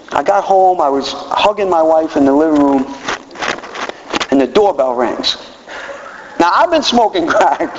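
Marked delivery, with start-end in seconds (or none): none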